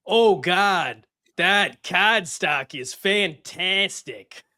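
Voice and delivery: slow, hammed-up dumb Boston accent; clownish Boston accent. Shown as a demonstration. Audition take in an over-the-top dumb Boston accent. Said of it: "hilariously dumb, clownish version of a Boston accent".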